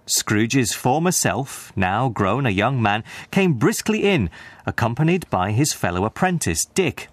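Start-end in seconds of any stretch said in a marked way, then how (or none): none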